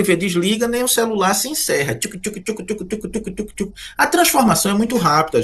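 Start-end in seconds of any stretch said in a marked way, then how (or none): 1.9-3.7 soft rhythmic tchuc tchuc clicks, higher; quiet scroll clicks. Retold or imitated. Professor mimicking a phone scroll for students, soft clicks. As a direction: flick a screen with little mouth clicks.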